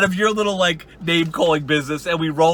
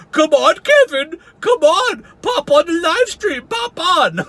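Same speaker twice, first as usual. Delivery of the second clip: much higher, slow taunting call; mock goading Wally; 10 semitones higher, 3 dB louder, slower than usual. Mocking Wally calling Kevin into the stream, high and slow. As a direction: bait Kevin like Wally does. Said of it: "you're like".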